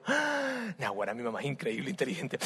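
Jacob marvels at his mother, soft amazement; amazed gasp. Acted.

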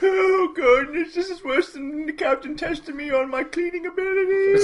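high, frantic wail; panicked squeal. Acted. Barsher wails about the captain's cleaning test. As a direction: whine like a terrified cadet.

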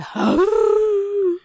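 mock howl. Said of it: "Like that?".

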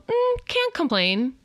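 high, slow "Mm, can't complain"; lightly mocked stock reply; mock glum reply. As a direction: parody a half-hearted answer.

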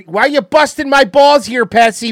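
put-on loud, high New York cop accent; New York cop accent yelled at a cohost; NYPD Blue cop accent. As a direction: bust his balls like a New York detective. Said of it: "I'm sorry I'm doing the accent".

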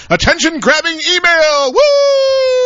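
high, whooping mock excitement; attention-grabbing announcement. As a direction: read the title as a showy yell, ending in a soaring whoop. Acts out the email's title.

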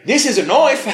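laughing bad Australian "This is a knife", slipping Irish; mock Australian accent. Co-host joins the Dundee quote in a wobbly accent. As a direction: ham up the movie line, accent drifting. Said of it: "Irish or, like, Scottish accent".

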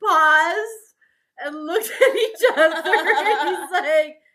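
high, laughing through the story; laughing tears into the words. Real, not acted. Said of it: "laughing so hard right now".

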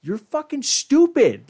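high, slow, mock-exasperated squeal; mocking scorn. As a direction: scold them like a shrieking fed-up viewer, dragging it out. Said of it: "but you're also like".

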